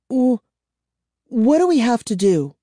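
put-on high-pitched, stammering girl; nervous stammer. A narrator's reading. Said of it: "Kara stammered".

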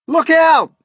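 high, loud cry of warning; alarmed shouting. A narrator's reading. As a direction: yell a warning to everyone near. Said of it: "they cried".

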